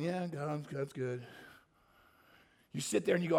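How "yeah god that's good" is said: half-hearted praise. Retold or imitated. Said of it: "I don't stand there during worship time going".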